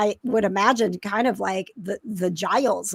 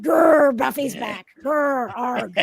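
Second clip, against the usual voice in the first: growling mutant enemy voice; rasping strained mutant; 4 semitones higher, slower than usual. Acted. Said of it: "You got your mutant enemy voice".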